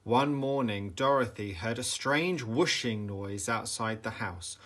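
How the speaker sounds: plain reading voice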